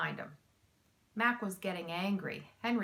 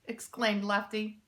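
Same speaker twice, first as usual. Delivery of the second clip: usual speaking voice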